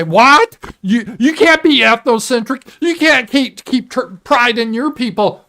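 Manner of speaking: high, loud indignant parody voice; hammed-up shocked lecture; mocking outraged critic. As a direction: squawk like the scandalized scold. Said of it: "the same ones that say".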